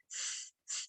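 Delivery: long unvoiced hiss like a pressure cooker. Shown as a demonstration. Teacher demonstrating the whistling sound: steady hiss. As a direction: let the air hiss out through near-closed teeth. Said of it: "س کی آواز آتی ہے".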